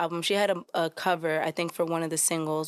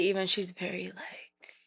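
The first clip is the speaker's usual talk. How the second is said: soft, airy whisper; whispered, as a zen soul singer; hushed impersonation; 7 dB quieter than usual. Acted. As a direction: drop into a serene whisper.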